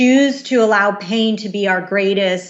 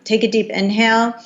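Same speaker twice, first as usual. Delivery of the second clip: ordinary talk, nothing marked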